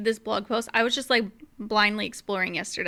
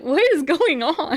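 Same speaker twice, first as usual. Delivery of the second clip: slow, higher, hammed-up confusion; mock bewilderment; 4 semitones higher, 4 dB louder, slower than usual. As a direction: act out being utterly baffled. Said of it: "I was just like".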